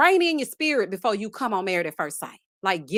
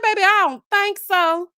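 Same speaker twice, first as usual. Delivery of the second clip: sassy refusal; 8 semitones higher, 6 dB louder than usual. Retold or imitated. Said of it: "I said".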